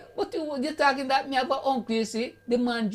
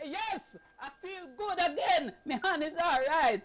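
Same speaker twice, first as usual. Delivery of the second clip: put-on 1 old lady; 7 semitones higher, 5 dB quieter than usual. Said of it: "and she said".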